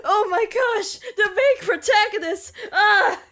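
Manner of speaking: high, loud line breaking into laughter; excited recognition that cracks into giggles; laughing voice act. Acted. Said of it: "I don't know how to voice act that".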